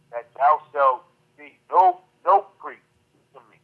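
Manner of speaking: usual speaking voice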